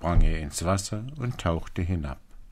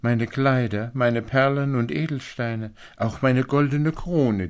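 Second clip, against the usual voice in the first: higher, louder princess voice; eager princess; 6 semitones higher, 5 dB louder than usual. A narrator's reading. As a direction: offer it all eagerly, pitched up. Princess offers her treasures. (eifrige Prinzessin, hoch und laut)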